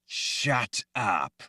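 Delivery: cold command in a borrowed voice; terrible voice. A narrator's reading. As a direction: silence her with someone else's voice. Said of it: "in that terrible voice only half her own".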